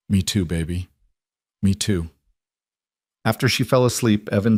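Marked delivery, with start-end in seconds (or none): 0.1-2.1 choked up. Acted. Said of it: "Evan's throat tightened".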